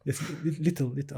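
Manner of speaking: softly mimicked Russian accent; imitated Russian accent. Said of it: "that was a Russian accent".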